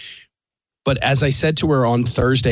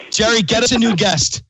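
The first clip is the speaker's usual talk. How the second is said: mock call to producer; 10 semitones higher than usual. Host calls to producer Jerry, hammed-up demand. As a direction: pretend to fire the guest.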